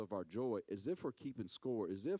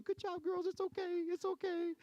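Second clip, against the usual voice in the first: very high, sugary praise; sweet coach voice. Retold or imitated. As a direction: coo it like a gentle coach to little girls. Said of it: "I'm over there going".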